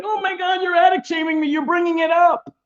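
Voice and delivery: high whiny imitation of a touchy victim; lightly mocking falsetto victim. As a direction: whine it high like you're offended. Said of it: "you think I'm like".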